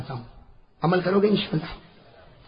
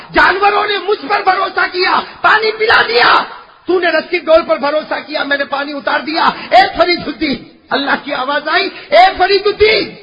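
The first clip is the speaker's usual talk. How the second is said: high, shouted reproach; full-force preaching; 11 semitones higher, 12 dB louder than usual. Acted. Preacher voicing Allah's reproach to the saint, impassioned shout. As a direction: hammer each phrase out loudly.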